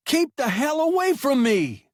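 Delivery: high, loud scream. A narrator's reading. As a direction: scream it at him. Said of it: "the boy screamed".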